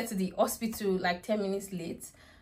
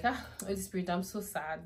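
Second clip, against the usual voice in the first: softer, weary lament; sad sigh to God; 8 dB quieter than usual. Retold or imitated. Mother crossing the road after the missed appointment, sad and soft. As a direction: sigh it out, worn down. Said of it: "I was still feeling sad and overwhelmed".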